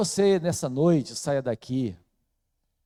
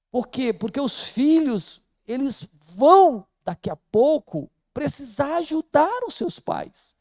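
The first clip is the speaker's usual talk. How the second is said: higher and louder, pressing the point; fervent preaching; 10 semitones higher, 5 dB louder than usual. Acted. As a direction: drive the warning home to the young people. Urging youth to prepare.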